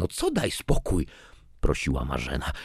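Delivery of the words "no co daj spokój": higher, exasperated retort; indignant echo. A narrator's reading. Father answers his daughter's plea, indignant. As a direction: throw the plea back as a challenge.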